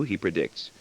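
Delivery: ordinary delivery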